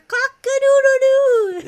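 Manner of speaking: high, slow, loud rooster call; rooster crow. Shown as a demonstration. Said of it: "it's a rooster".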